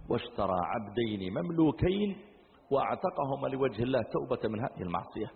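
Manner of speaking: ordinary talk, nothing marked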